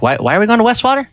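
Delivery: quick, high-pitched bewildered question; puzzled questioning. Acted. Companion asking why they are travelling, quick and puzzled. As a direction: stumble into it fast, rising in confusion.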